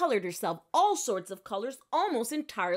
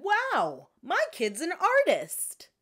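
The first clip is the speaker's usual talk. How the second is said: delighted mother; 9 semitones higher, 4 dB louder, slower than usual. Acted. Mother admires drawings.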